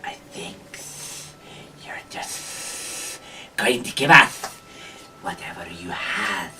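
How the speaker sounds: slow, whispered creature threat; whispering kobold. Acted. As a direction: whisper the demand slowly as a kobold. Kobold answers the players with demand.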